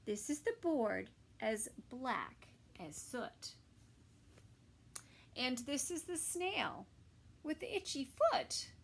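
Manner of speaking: put-on lively storybook reading for kids; reading to children. A narrator's reading. Narrator reading a story to children, lively. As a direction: read it like a bedtime picture book. Reading picture book aloud.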